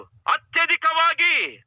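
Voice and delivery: high, slow, stretched proclamation; voice of God. Acted. God promises Abraham great increase, high drawn-out proclamation. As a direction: draw the word out high as Almighty God.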